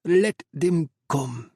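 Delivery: slow, menacing gloat. Acted. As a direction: savour the threat slowly. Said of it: "Al Jabal crowed".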